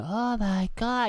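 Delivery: high, slow mock amazement; drawn-out high gasp of wonder; awestruck kid. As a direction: imitate a child blown away, drawing it out. Voicing his amazed young son.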